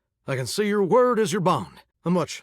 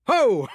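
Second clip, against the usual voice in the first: hearty laugh; 8 semitones higher than usual. Acted. Shower laughing at the visitor's joke, a hearty burst.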